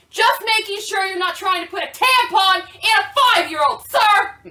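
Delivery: acted loud accusation; loud confrontation. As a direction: call them out loudly, hitting the final "sir". Acted confrontation outside the stall.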